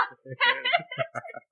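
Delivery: high, bright burst of laughter; high happy laugh; delighted laugh. Acted. Laughing after the rhyme.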